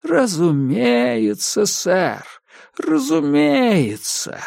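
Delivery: slow, high, oily sing-song; unctuous singsong. A narrator's reading. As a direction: croon it sweetly, mock-soothing. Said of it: "пропел инспектор елейным голосом". (елейно, нараспев)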